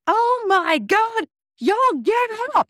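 very high, loud, drawn-out squeal; abrupt burst of high, loud excitement; excited outburst. Retold or imitated. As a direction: shriek it in amazement, stretching the words. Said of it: "the quickest transition I've ever done".

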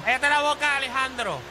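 high, sharp teacher scolding. Acted. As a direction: snap at the student.

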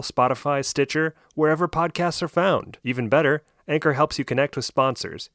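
ad read. Acted. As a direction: sell it like a radio ad. Engineer reads sponsor ad.

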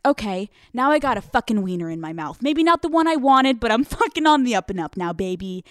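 loud, pumped-up self pep talk; hyped-up. Retold or imitated. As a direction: bounce back loud and cocky, pitch climbing. Hyping self up after a setback.

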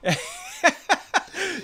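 a sudden high squeal of laughter. Real, not acted. Cracking up while telling the co-host's prank story, high squealing laugh. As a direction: burst into a shrill giggle.

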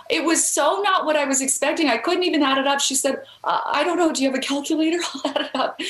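high, giddy disbelief with a laugh; stunned and excited. Retold or imitated. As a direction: blurt it out, overwhelmed with joy. Lynn stunned by the huge offer.

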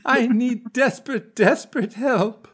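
very high, slow, breathy-sounding plea; a ghostly siren begging for help; haunting beautiful voice. Acted. Guest pleads with Stephen for help, haunting high slow voice. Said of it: "says in the most haunting, beautiful voice".

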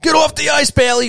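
high-pitched imitation of a yelling fan; heckler yell. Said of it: "without hearing somebody yell".

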